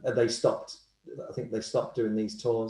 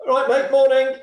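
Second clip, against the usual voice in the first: bright, loud, high hello across a corridor; high, loud, chirpy morning call; cheery greeting; 10 dB louder than usual. Retold or imitated. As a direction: sing out a breezy good morning. Cheery greeting from notorious killer.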